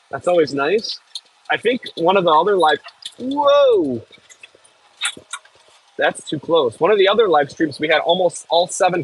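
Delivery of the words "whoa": sudden high startled cry; jolted by a near miss; real shock, pitched way up; startled whoa. Real, not acted. Nearly hits the deer fence.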